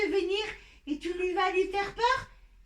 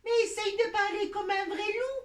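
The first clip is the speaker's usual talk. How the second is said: ordinary talk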